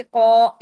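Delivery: "कौ" with the mouth wide open, thick and long; heavy, rounded "कौ"; full-mouthed heavy sound. Shown as a demonstration. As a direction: fill the mouth on "कौ". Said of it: "मुंह भर के आप पढ़ेंगे".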